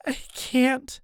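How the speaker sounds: high, tearful mother; tearful, high-pitched protest; through tears. A narrator's reading. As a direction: plead weakly in a high crying voice. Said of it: "Mom says through tears".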